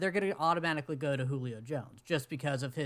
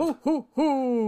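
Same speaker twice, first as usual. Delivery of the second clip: slow, high mock Santa laugh; a hammed-up Santa chuckle; 8 semitones higher, 6 dB louder, slower than usual. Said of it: "we're going to play Tepper Claus".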